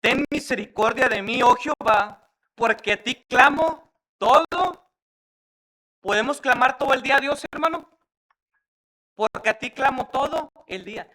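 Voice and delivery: fervent, high-pitched preacher's declamation; full-force preaching. Real, not acted. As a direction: cry the psalm out to the congregation. Proclaims psalm at full force.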